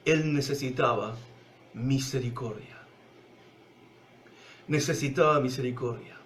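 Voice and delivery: low, soft sermon voice sinking to a hush; intimate preaching. Acted. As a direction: draw the listeners close, quietly. Preaching softly, slowing. (predicación íntima, baja y suave)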